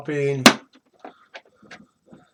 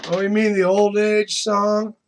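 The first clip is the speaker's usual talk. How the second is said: normal talking voice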